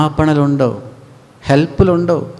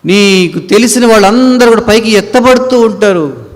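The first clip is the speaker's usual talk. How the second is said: higher, louder preaching build; full-force preaching; 7 semitones higher, 5 dB louder than usual. Acted. Preacher warning listeners about the rapture, loud preaching. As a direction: proclaim it to the congregation.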